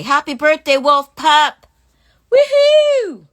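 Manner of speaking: loud, high whooping congratulations; excited birthday cheer. Real, not acted. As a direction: shout the greeting, building to a high whoop. Birthday shout-out to a viewer.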